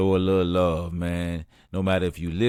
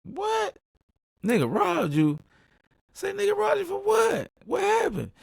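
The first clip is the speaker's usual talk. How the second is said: much higher, shouted disbelief; shocked outrage. Retold or imitated. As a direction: friend says he got robbed, shout in shock. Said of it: "I'm like".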